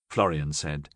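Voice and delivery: usual speaking voice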